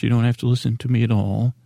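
ordinary talk

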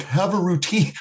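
high-pitched mocking quote; mocking impression. Poking fun at a sleep expert on a podcast, mocking. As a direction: mimic the expert in a put-on high voice. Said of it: "not trying to make fun".

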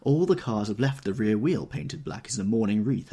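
ordinary delivery, nothing marked

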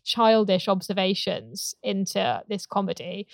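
usual speaking voice

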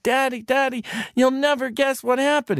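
put-on high, loud breathless child; high thrilled kid voice; excited little boy. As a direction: burst in bursting with news. Boy bursts home to father.

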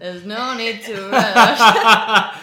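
drawn-out laughing advice; slow and laughing. Real, not acted. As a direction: say it slowly while laughing.

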